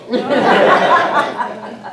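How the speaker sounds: loud burst of real laughter; open, loud laugh; hearty laugh. Real, not acted. Big laugh at her own story.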